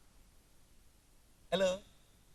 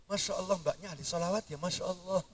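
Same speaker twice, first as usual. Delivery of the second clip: soft admiring gush; 4 semitones lower, 12 dB quieter than usual. Acted. Mock admiring a woman.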